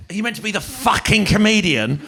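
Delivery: hammed-up heckler sneering at the comedian; rude audience member. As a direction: sneer it at him as you walk in. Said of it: "they'd be sort of like".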